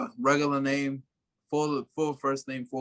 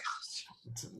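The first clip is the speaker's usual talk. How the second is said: whispered reaction; 18 dB quieter than usual. Retold or imitated. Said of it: "And they're like".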